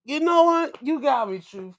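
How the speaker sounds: loud, high, delighted concession; amused surrender. Retold or imitated. Conceding the joke to Truth, loud amused surrender. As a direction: give it up to him, laughing inside. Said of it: "I was like".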